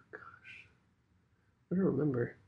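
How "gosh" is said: unvoiced whispered gosh. Real, not acted. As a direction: breathe it under the breath. Muttered aside.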